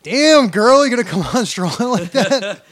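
high, loud hammed-up catcall; big swooping, high-pitched comic come-on; mock flirting. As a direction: exclaim it like an overexcited flirt. Acting out flirting with the cashier.

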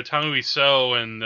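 normal talking voice

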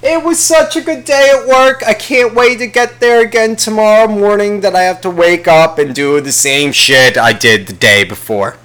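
high, giddy gushing over work; mock gushing worker. Mocking someone thrilled about work, giddy and high. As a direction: gush about work like it's heaven.

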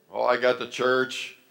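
ordinary talk, nothing marked

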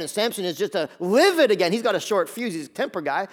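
ordinary talk

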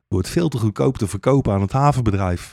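usual speaking voice

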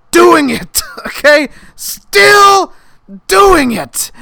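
real frustrated outburst; exasperated shout. Real, not acted. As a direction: rant at full volume in disbelief. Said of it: "I don't understand why".

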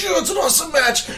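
mock hype. Mock crowd praise, voice raised high.

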